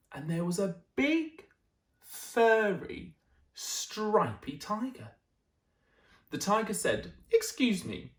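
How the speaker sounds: put-on lively storytelling voice for children; animated read-aloud; bedtime story narration. A narrator's reading. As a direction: read it like a picture book at bedtime. Reads picture book aloud.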